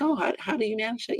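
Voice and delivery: a touch of high, soft curiosity; mild interested reaction. Acted. Acting a listener hearing of bipolar, softly curious. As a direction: lightly act a curious, accepting reply. Acted casual reply to mental-illness disclosure.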